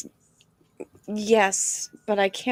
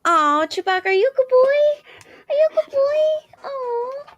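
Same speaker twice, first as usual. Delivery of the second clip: high cooing pet voice; 8 dB louder than usual. Real, not acted. Wife cooing at their dog Chewbacca in high baby talk. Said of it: "that's what they hear on hers".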